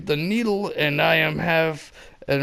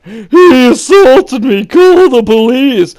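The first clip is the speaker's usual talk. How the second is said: high, loud accusing shriek; mock hysterical woman; Karen shrieking; 10 semitones higher, 14 dB louder, slower than usual. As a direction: shriek like an entitled shopper.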